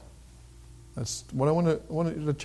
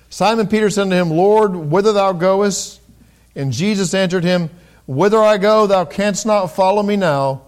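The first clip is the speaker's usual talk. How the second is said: scripture reading; 5 semitones higher, 6 dB louder than usual. Acted. Pastor reads John to the congregation, raised and solemn.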